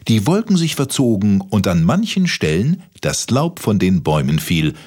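ordinary delivery, nothing marked

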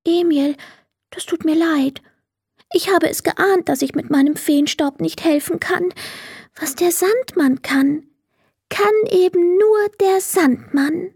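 near tears, apologising; sad fairy. Acted. Fairy apologises to Emil, sad and near tears. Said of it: "sagte traurig".

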